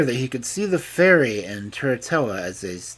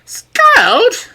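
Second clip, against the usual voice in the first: much higher, loud offended shriek; shrieked outrage; 15 dB louder than usual. A narrator's reading. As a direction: screech at the insult. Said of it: "cried Turritella".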